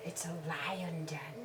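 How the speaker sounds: whispered, slow and hushed, It's a lion. Acted. Mother whispers to John that it's a lion. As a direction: breathe it softly to build suspense.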